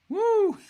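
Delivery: loud, piercing, very high woo; high whoop. Real, not acted. Streamer admiring the car's rear, high whoop.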